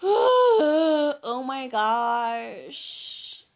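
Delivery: very slow exasperated sigh; long dramatic sigh. Real, not acted. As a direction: sigh it out, overwhelmed.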